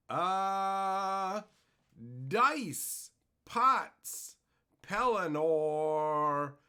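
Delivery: punchy announcer-style name calling; each name called out high, then falling; roll-call shout-out. Real, not acted. Streamer greeting viewers by name in chat, calling out.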